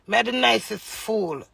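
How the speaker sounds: ordinary talk, nothing marked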